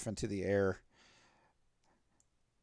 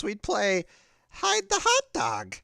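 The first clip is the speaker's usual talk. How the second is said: pitched-up silly character; high silly voice; 9 semitones higher, 3 dB louder than usual. Acted. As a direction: squeak it out like a goof. GM as Dov, joking.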